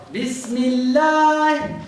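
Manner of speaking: Bismillah drawn out slowly, doubled l held long. Shown as a demonstration. Teacher modelling Bismillah slowly for students. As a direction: stretch out Bismillah, holding the double l. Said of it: "say Bismillah".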